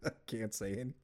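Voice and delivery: laughing. Real, not acted. Cracking up mid-podcast.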